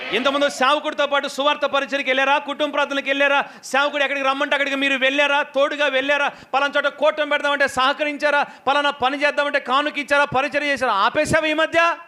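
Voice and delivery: rousing sermon exhortation; fervent preacher's call; preaching. Acted. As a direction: urge the church to begin the work. Preaching to the congregation.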